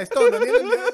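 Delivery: high, loud laughing shout; laughing cheer. Real, not acted. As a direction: cheer his name through laughter.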